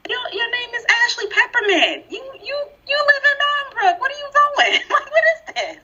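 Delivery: high-pitched, incredulous mock challenge; squealed disbelief breaking into laughter; mocking incredulity. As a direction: call out a poser, voice way up.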